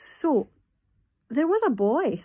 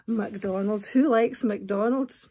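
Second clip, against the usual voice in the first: ordinary delivery, nothing marked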